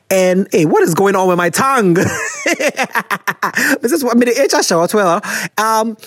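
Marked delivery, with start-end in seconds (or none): 2.0-3.8 higher real giggling burst; amused laugh. Real, not acted. Host trips over her tongue on air, laughing. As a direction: laugh at your own slip.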